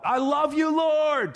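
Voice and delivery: high, sustained call of praise; sing-out yell on a high pitch; demonstrated worship shout. Shown as a demonstration. Preacher showing how to yell out to the Lord, demonstrated shout. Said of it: "to yell out".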